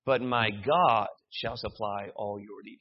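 God punched loud and drawn out; stress on God. Shown as a demonstration. Preacher rereading the verse a third way, stress on God. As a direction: land hard on God, slowly. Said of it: "Or we could read it".

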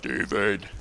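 low, quiet booming call of a name; a hint of a divine voice calling; lightly voiced God calling down; mock voice of God. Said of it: "not audibly, it wasn't like".

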